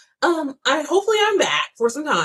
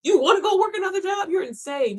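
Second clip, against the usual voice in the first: incredulous rant; 9 semitones higher than usual. Real, not acted. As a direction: shout down their excuses.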